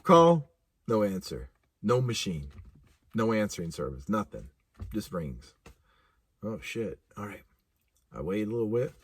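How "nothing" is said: ordinary delivery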